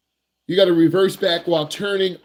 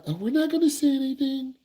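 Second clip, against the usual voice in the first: higher, softer sing-song parent voice; mock parents; 8 semitones higher, 5 dB quieter than usual. Parents avoid pushing an anxious teen to drive, mock gentle. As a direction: lightly voice tiptoeing parents. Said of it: "your parents are like".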